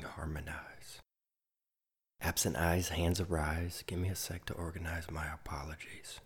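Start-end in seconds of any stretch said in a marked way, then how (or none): 0.0-1.0 a soft whispered word. Acted. Poet closing the morning image, whispered. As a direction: let it fall to a whisper.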